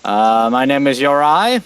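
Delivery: slow, lifted call upward; calling back up. Acted. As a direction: call up to an unseen listener, word by word. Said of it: "Uriah will call back up".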